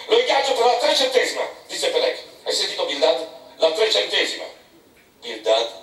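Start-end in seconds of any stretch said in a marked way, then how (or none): 0.1-4.5 a touch higher, insistent captain; lightly voiced bluff sea captain; Peleg. A narrator's reading. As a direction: Peleg pressing Bildad, slightly higher.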